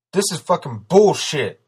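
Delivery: high, loud outburst; loud, fed-up protest; angry shout. A narrator's reading. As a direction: shout it with BULLSHIT hit hard. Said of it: "Steele shouted".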